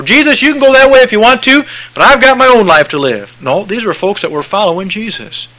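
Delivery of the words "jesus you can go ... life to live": mock stubborn follower. Mocking folks who refuse Jesus.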